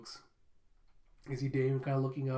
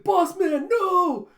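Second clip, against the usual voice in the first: much higher, much louder scream of "no"; shrieked, much higher and louder anguish; 13 dB louder, much slower than usual. Retold or imitated. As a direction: scream as your boss goes under. Damien falls overboard.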